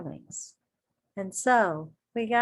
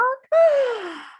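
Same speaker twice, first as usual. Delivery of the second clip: loud high gasp of amazement; big gasp; 7 dB louder than usual. Acted. Reading to kids, amazed gasp. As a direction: gasp like you just found out.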